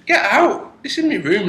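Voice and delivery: very high-pitched protest; indignant order. Retold or imitated. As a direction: order the intruder out, pitch right up. Ordering the intruder out.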